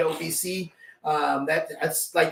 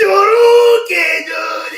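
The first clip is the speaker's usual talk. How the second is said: mock crying whine; 11 dB louder, slower than usual. Teasing him about crying.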